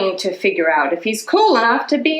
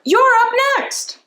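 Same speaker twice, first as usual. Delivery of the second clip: high called announcement; calling out. A narrator's reading. Emilio calling Justin to play, high call. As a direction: shout it across the playground. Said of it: "Emilio calls".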